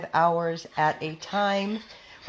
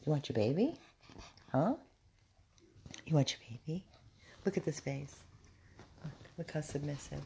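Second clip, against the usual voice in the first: soft dog talk; 5 semitones lower, 11 dB quieter, much faster than usual. Real, not acted.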